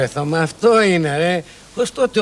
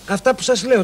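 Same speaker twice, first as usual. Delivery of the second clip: normal talking voice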